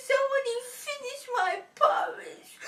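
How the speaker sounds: high, loud, hammed-up sobbing; crying Baby Bear. A narrator's reading. Baby Bear finds porridge finished, exaggerated high crying. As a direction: wail like a little bear child. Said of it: "Baby Bear cries and cries".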